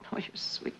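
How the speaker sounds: breathy tender whisper; whispered fondly to her lover on the phone; soft whisper. Acted. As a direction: murmur it close to the receiver.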